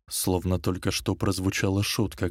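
plain reading voice

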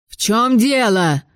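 медленно, другим голосом; рявкнуть в ярости; гневный окрик. A narrator's reading. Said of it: "гневный вопль". (садовник гневно кричит из-за двери)

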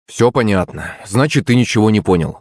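ordinary delivery, nothing marked

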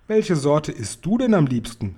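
ordinary delivery, nothing marked

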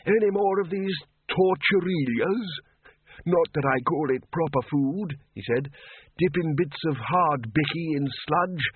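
put-on high creaky old man's voice; chirpy doddering wizard; high thin old man, chipper; old Windle. A narrator's reading.

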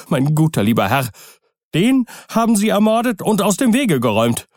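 high, frantic, insistent servant; highly agitated. A narrator's reading. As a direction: pour it out in a frenzy. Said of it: "in höchstem Grade erregt".

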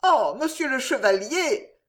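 gentle high reproach to the knight; innkeeper's wife. A narrator's reading. Wife to the knight who spared her husband, mildly reproachful. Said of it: "dit-elle avec un regard".

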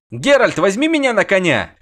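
very high call to wait; high pleading call. A narrator's reading. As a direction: call after the riders. Dandelion begs for ride.

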